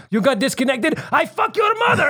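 mock rage rising to a high shout; angry guy. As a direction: imitate a scammer snapping into fury. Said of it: "I got the angry guy".

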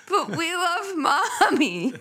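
laughing imitation of crying kids; mock crying kids. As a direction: wail it like a crying child. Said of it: "they all started to cry".

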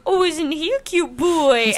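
high, slow cooing; baby talk to a dog. Retold or imitated. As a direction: coo sweetly at the dog.

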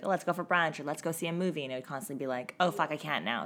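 ordinary talk

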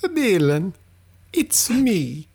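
high, put-on Italian uncle voice; high mock Uncle Mario. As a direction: play the long-lost uncle, high and hammy.

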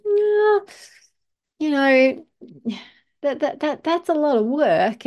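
high, hesitant whiny inner voice; bitch voice. Acted. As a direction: play the nagging little voice of self-doubt. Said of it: "that little bitch voice starts to go".

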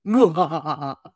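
short made-up ghostly laugh; a hint of a sinister ghost chuckle; spooky laugh. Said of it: "I made up the laugh".

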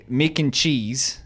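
exaggerated Australian vowels; Australian accent. Shown as a demonstration. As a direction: draw it out in a put-on Aussie accent. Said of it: "an Australian guy would be like".

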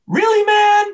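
an incredulous "really, man?" with a laugh; incredulous disbelief. Retold or imitated. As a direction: play Kobe's disbelief, laughing at the question. Kobe mocking the reporter.

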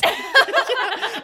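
high, loud burst of laughter; a squealing cackle of delight; shrieking laugh. Real, not acted. Reacting to the retold exchange.

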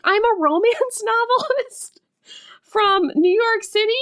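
very high prissy voice; mock heroine explains herself. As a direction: pitch way up, hammed-up damsel. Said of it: "she's like".

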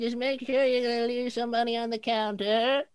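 put-on old lady voice. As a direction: call it out, old woman at the counter. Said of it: "she's like".

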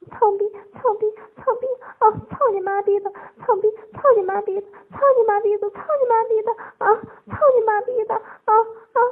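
rapid, high, loud chanting cries; frantic high-voiced outcry; continuous cries. A narrator's reading. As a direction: repeat it urgently without letup, pitched high. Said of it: "连续一声地说".

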